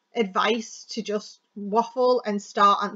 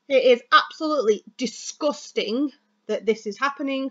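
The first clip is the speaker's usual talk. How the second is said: "absolutely disgusting" punched for emphasis; emphasized keywords; 3 semitones higher, 4 dB louder than usual. Shown as a demonstration. Coach giving an example of key words, strong stress on "disgusting". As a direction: lean hard on "disgusting" as the key word. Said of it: "I'm asking you to emphasize keywords".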